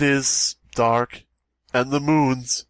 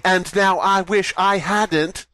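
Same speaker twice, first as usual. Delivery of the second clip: slam poetry; 5 semitones higher than usual. Pretend. Slam poem closing line.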